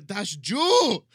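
loud, drawn-out mock German accent; best German accent. Host giving his best shot on request, loud German accent. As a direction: belt it out in hammed-up German. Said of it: "Give me your best German accent".

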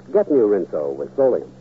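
ordinary talk, nothing marked

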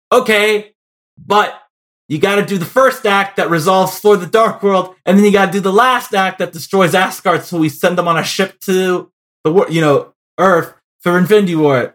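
insistent mock producer, stressing each requirement; studio boss. Studio head telling a director what the movie must do, insistent. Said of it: "And then Kevin F-, Feige is like".

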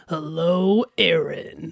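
low sultry monster growl; hormone monster voice. Shown as a demonstration. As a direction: drop low and purr the name. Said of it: "that's the, uh, hormone monster".

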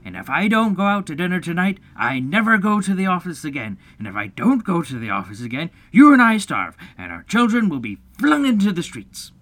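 emphatic, escalating ultimatum; stern. A narrator's reading. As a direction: warn like a melodramatic head of household. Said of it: "he went on sternly".

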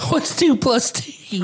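silly voice. Pretend. Joke quiz in odd voice.

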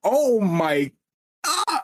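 hyped. Retold or imitated. Reenacting hyped reaction to fight.

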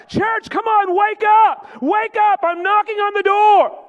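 high shouting call, pitch way up; crying out. Acted. Preacher voicing Jesus calling the church, shouting. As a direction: cry out to wake the church. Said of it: "it's him crying out".

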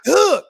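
boxer's punch grunt. Retold or imitated. Acting out the rooftop boxer fighting Jason, punching grunt. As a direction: imitate a hard jab with a grunt.

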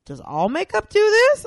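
high, loud, incredulous question; mock astonishment. Guest retells shopgirl's makeup revelation, loud mock amazement. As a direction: imitate someone whose mind is blown.

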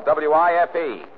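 each letter, W I F E, spaced slowly and loudly; spelled out. Acted. Detective spells W I F E out slowly to the killer. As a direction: spell W I F E out letter by letter to nail him. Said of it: "I'll spell it for you".

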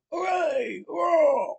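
high, excited cheering shout. A narrator's reading. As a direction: yell it like a rowdy crowd. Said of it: "they heard".

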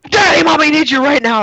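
put-on a scared young child waking his dad; imitating son. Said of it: "and I hear".

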